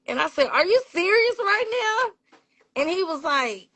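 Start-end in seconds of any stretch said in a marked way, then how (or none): 0.5-2.1 high-pitched, incredulous question; retold disbelief. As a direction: act out stunned disbelief. Retells her disbelief at the compliment.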